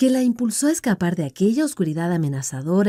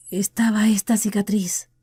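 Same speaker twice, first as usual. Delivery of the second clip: a hushed whisper; whispered confession; 3 semitones higher than usual. Acted. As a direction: breathe it out, ashamed. Tim admits his scar.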